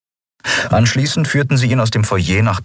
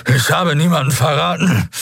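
high-pitched, strained denial; drugged captive insisting; desperate protest; 9 semitones higher than usual. A narrator's reading. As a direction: protest innocence with effort, voice pushed high. Drugged captive protests his innocence.

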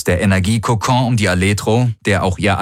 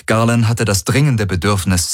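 plain reading voice

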